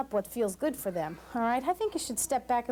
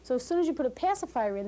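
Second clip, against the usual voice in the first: ordinary delivery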